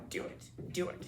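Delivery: breathy sinister whisper urging; evil whisper. Retold or imitated. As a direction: hiss it like a tempter. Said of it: "this really evil voice".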